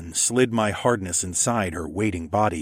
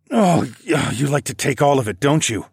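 raised, effortful grunt; 5 semitones higher, 4 dB louder than usual. Acted. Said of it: "I grunted".

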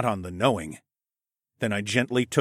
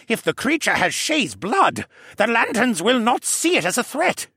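put-on raised, gentle voice of the old koala scholar; Jenner's voice; 13 semitones higher, 5 dB louder than usual. A narrator's reading. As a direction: speak up as the fussy, kindly koala gentleman. Jenner worries, narrated.

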